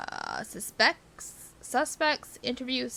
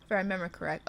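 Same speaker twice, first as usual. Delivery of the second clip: ordinary talk, nothing marked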